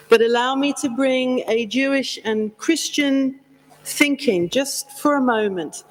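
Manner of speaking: normal talking voice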